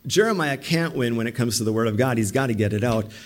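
usual speaking voice

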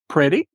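"Pretty" said alone, weight on PRET; first-syllable stress. Shown as a demonstration. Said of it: "the accent falls on the first part".

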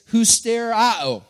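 "husterao" said slowly, stressed on the final "ao"; careful Greek "husterao", accent on the end; proper Greek pronunciation. Shown as a demonstration. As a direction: sound out "husterao" with an open "ah-oh" ending. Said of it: "Properly pronounced, it's".

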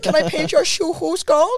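high, slow Norwegian impression; drawn-out mock Norwegian accent. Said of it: "do a Norwegian accent".

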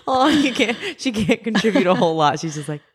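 high, squeaky laughing talk. Real, not acted. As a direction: giggle the words out. Laughing at her sick co-host.

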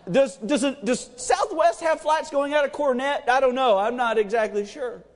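usual speaking voice

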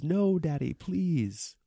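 put-on high childlike plea; mock kid voice begging his dad; child pleading. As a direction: beg like a small boy. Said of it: "Where he says".

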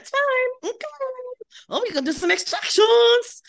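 very high, loud mock French accent; [name]'s French accent. Facialist announcing extractions to client, high French accent. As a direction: sing out like a hammed-up French facialist. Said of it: "she's got a French accent".

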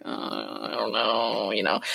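low, slow, soft mumbling shrug; dazed shrug. Retold or imitated. Answering her mom's push to work, in a low, slow, dazed mumble. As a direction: drift off as if lost for an answer. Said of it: "And I'm like".

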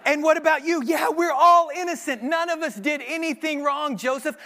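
mock inmate chorus. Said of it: "everyone else in the circle says".

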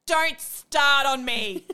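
a loud, dragged-out mock outburst; snapping at mum. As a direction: hurl each word back like a sulky teenager. [name] snapping at her mother.

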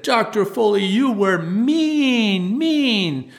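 put-on high, drawn-out children's chorus; children in unison. Little class answering the instructor in unison, chanting high and long. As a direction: stretch out MEAN like a class of accusing kids. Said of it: "everyone in unison said".

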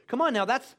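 normal talking voice

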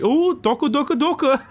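high-pitched mock orc gunfire chant; orc commando. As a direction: squeal it out like a cartoon orc shooting. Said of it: "the orc shoots him and it's like".

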